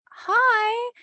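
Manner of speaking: very high, drawn-out hello; high-pitched greeting. Retold or imitated. Greeting the stranger on a video call, high-pitched.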